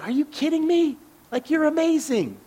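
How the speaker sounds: can't believe they don't see it; high disbelieving encouragement; incredulous praise. Retold or imitated. Listener insisting singer is amazing.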